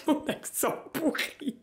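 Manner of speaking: high, slow words through real laughter; laughing. Real, not acted. Vlogger mocking her own English accent, helplessly laughing. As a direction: squeeze it out while laughing at yourself.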